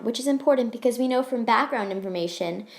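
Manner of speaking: usual speaking voice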